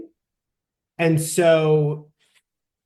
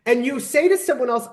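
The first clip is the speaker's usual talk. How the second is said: normal talking voice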